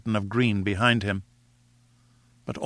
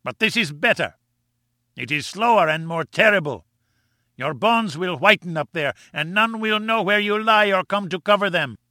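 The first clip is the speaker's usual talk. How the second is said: vengeful villain; 7 semitones higher than usual. A narrator's reading. Gomez vowing vengeance for his brother, high gloating voice. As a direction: savour every word of the revenge.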